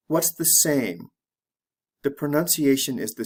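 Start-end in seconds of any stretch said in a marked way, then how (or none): none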